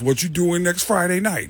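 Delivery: lightly put-on voice, casual question; retold star comedian. Retold Tracy Morgan talking to teller.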